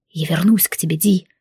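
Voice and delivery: unvoiced whisper, low; whispered vow to come back; whispered promise. A narrator's reading. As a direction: whisper it into her ear. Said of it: "прошептал он".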